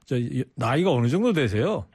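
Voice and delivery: ordinary delivery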